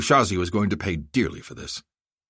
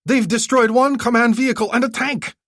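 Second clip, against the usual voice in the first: panicked warning; 6 dB louder than usual. Acted.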